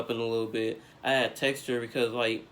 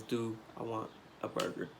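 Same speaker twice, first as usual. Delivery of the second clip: softer, flat disbelief; quiet disbelief; 11 dB quieter than usual. Retold or imitated. Speaking to his girlfriend, softer and flatly disbelieving. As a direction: let it drop off in quiet disbelief. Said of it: "the first thing you ask me".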